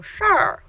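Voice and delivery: emphatic "shar" with a retroflex r. Shown as a demonstration. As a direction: pop up on "shar" to show the curl. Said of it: "a little extra retroflex sound".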